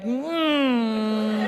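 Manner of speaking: drawn-out clueless humming moan; long held mm, at a loss; helpless hum. Retold or imitated. Comic as husband not knowing what to do, helpless hum. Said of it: "I didn't know what to do".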